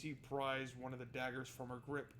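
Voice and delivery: firm, driving battle narration; lively story narration of a sword fight; action narration. A narrator's reading. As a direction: read the fight with momentum.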